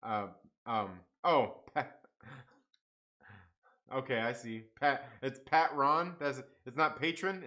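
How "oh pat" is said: usual speaking voice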